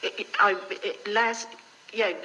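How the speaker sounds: ordinary talk, nothing marked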